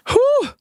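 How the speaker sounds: high, airy whew of relief; overwhelmed whew. Real, not acted. Vlogger overcome by a lucky break, high whew. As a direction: let out a big whew. Overwhelmed sigh while telling happy news.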